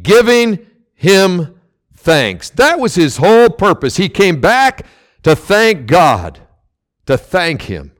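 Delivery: normal talking voice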